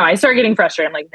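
ordinary delivery

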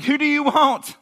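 loud retold announcer-like call; calling out to a crowd. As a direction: throw the question out to a crowd. Pilate calls out to crowd.

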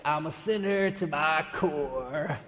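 imitating a country singer, drawn out with a twang. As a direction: croon it like a Boston country singer, leaning on core. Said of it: "Except it sounds like this".

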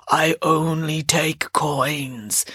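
slow, low whispered boatman; hushed, slow otherworldly ferryman. Acted. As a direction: breathe it slowly like a ferryman of the dead. Boatman refuses payment.

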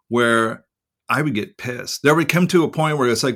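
usual speaking voice

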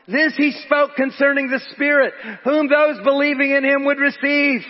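ringing, emphatic pulpit delivery; high, forceful preaching. Real, not acted. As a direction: proclaim it to the whole congregation.

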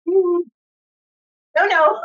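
firmly stopping the pet dog. Real, not acted. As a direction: tell the dog no, loud and high.

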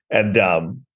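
usual speaking voice

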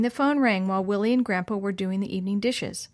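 plain reading voice

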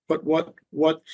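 ordinary talk, nothing marked

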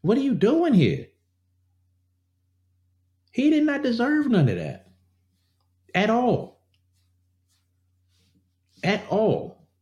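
high, pained outrage; outraged disbelief. Real, not acted. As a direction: cry out at the injustice, high and strained. Outrage at beating.

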